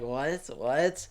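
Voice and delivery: quiet, half-hearted mocking what; imitating a smirking council member, a touch. Commentator mimics Mister Smith's smirk, mild mocking. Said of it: "Your smirk's like this, like".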